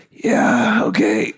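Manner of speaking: choked-up yeah; tearful acceptance. Retold or imitated. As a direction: say it as if fighting tears. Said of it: "I'm like".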